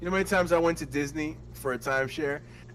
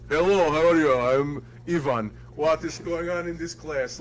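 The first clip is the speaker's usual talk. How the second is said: put-on Russian accent. Prankster posing as Ivan in timeshare class, Russian accent. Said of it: "British, Russian? What do you wanna do?".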